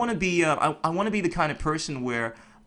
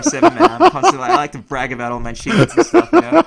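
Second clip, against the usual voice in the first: laughing through the words; chuckling while talking; 10 semitones higher, 11 dB louder than usual. Real, not acted. Bragging, laughing at himself.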